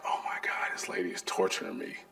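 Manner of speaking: hushed, voiceless complaint; whispered despair. Retold or imitated. After the boss's caption rules, whispered despair. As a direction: whisper it like she might hear.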